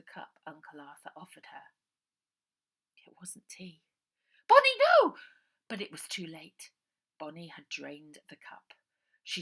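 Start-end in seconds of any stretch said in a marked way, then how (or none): 4.5-5.2 high, loud scream of warning; desperate scream. A narrator's reading. Ida warning her sister, high desperate scream. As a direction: scream to stop her drinking.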